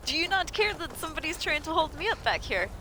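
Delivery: high-pitched plea for help; role-played indignation, ending in a shout; indignant appeal. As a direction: complain to guards who ignore you. Protesting to guards in game.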